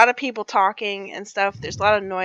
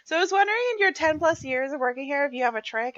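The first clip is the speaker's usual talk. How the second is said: higher-pitched mock voice of a clueless boss; mocking supervisor imitation; 5 semitones higher than usual. Worker imitating a moron supervisor's question, mocking. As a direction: imitate a hapless boss asking for help.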